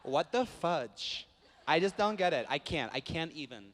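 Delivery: exaggerated Californian Valley girl whine; Valley girl accent. Shown as a demonstration. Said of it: "my go-to accent is always Valley girl".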